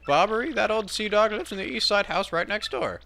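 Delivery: high-pitched game character voice; high, chattering informant; helpful townsperson. Acted. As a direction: pipe up high with local gossip.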